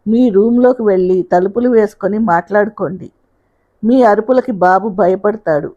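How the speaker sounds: ordinary delivery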